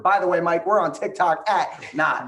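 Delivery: fast, high, loud auctioneer patter. Retold or imitated. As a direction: rattle it off like a county auctioneer. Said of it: "he talks like a Gundy County auctioneer".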